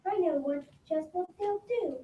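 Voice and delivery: ordinary talk, nothing marked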